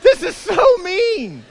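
high, drawn-out mock wail of protest; wailing child. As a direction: cry it out like a tormented little kid. Terrified child cries out at sister.

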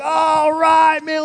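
high, drawn-out party holler; rowdy party cheer. Acted. Drunk king about to drink, hollering in mock revelry. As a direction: whoop it long and high like a reveller. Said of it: "He's like".

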